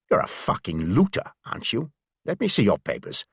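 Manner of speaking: lightly voiced Bavarian German accent on the English; a hint of a gruff Bavarian guard; a touch of Bavarian accent, accusing. A narrator's reading. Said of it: "He spoke with a strong Bavarian accent".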